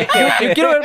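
laughing high while talking; words squeezed out through a laugh. Real, not acted. Guest laughing while doubting a fan's claim, laughing.

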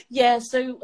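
ordinary talk, nothing marked

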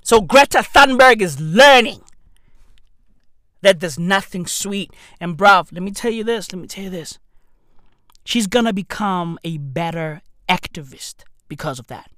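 ordinary talk, nothing marked

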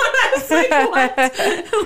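high, loud laughing "what?"; cracking up in high-pitched surprise. Real, not acted. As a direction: laugh through the disbelief. Laughing at the compliment.

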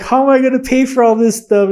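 high, loud exasperated worry; mock panic. New hire retelling his worry over bills, mock-panicked.

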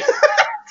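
a burst of high delighted giggling; high giggle. Real, not acted. Friend laughing high at a movie scene. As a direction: laugh high and helpless at the joke.

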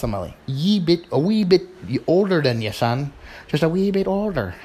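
high, lilting mock Irish; Irish accent. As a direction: put on a shaky amateur Irish accent. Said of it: "that was my Irish accent".

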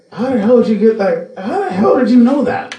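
high-pitched, incredulous demand; dad's disbelief. Retold or imitated. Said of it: "And he still says that".

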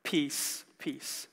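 "peace, peace" low and soft, dying away; understated. Shown as a demonstration. Preacher conceding only this much peace on earth, understated. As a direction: drop both words of PEACE down quietly, with a gap between. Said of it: "we get to experience only just".

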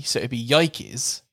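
"Yikes" in two syllables, "YIE-keez"; yikes like Nike. Shown as a demonstration. Host demoing the swap, yikes said like Nike. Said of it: "pronounced like Nike".

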